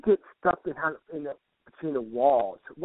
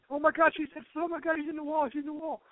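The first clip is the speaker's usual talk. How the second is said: very high, hushing as it goes; mock astonishment; 14 semitones higher, 4 dB quieter than usual. Passer-by realizing she's in the wall, high mock astonishment. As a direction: gasp it out in put-on falsetto.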